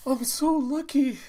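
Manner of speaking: high-pitched, overjoyed exclamation; elated. Acted. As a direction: cry it out, thrilled at your luck. Old man gloating in story.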